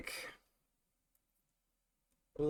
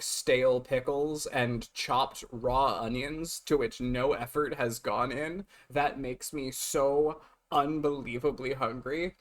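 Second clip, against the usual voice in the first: usual speaking voice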